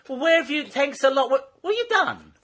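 high, tight-lipped mock scolding, slowing at the end; annoyed secretaries. Secretaries scolding the late receptionist, pursed-lip mock indignation. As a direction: play the cross secretary through pursed lips. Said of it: "with pursed lips and they went".

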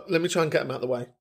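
ordinary talk

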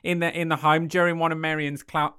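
put-on high, loud drifting Australian accent; accent sliding into Australian. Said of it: "She's going Australian".

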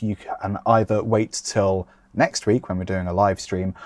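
usual speaking voice

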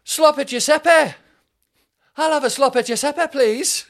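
loud, high, hammed-up Mancunian; Mancunian accent. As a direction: belt it out like a caricature Manchester uncle. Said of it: "which in a Mancunian accent".

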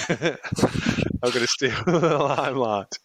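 laughing through the words; a real laugh breaking into the line. Real, not acted. Co-host jokes he's stealing the limelight, laughing.

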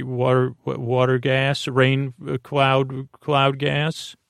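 normal talking voice

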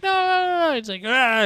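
very high, loud mock wail; mock panicked refusal. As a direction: imitate a frightened innkeeper refusing. Acting out the innkeeper's panicked refusal.

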